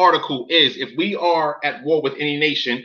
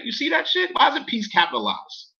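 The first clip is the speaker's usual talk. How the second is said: incredulous; 9 semitones higher, 4 dB quieter than usual. Real, not acted. Pointing out the capital letter.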